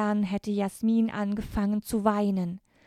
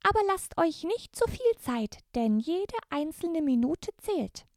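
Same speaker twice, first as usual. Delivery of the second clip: very high, squeaky elf voice; Christmas elf; 9 semitones higher than usual. Acted. The elf Sternenlicht greets the brave girls, very high elfin voice. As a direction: greet them as a tiny, courtly elf.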